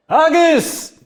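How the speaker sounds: very high, loud summoning shout; calling out. Acted. Innkeeper summoning his dwarf servant, shouted. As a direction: holler for the servant in the back.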